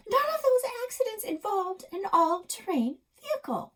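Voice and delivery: put-on high indignant child; indignant kid. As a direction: object hotly like a kid. Krista protesting to Grandpa.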